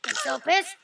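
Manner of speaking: ordinary talk, nothing marked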